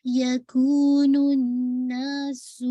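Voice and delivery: الناس with a clear whistling س; slow melodic recitation; whistling seen. Shown as a demonstration. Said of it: "جو whistling ہے وہ واضح ہو".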